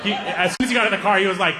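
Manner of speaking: ordinary talk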